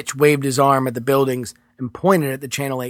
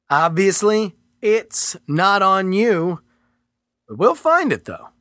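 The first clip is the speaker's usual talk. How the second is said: higher, firm and condescending; agent's character voice; 6 semitones higher than usual. Acted. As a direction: talk down to him coolly. Agent Merritt lecturing Mitchell.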